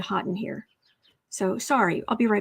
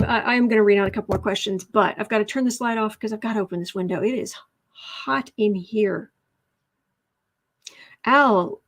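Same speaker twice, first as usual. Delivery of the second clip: ordinary talk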